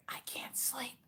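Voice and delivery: soft unvoiced child whisper; kid whisper. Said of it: "staring at you in the dark".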